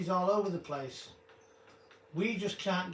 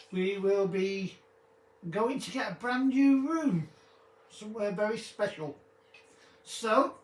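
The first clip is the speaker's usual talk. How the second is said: louder, slow theatrical farewell; grand sign-off; 3 semitones higher, 5 dB louder than usual. Acted. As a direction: announce the goodbye like a host.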